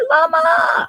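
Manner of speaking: llama squeaked very high and loud; squeaky high shout. Acted. Calling out llama excitedly.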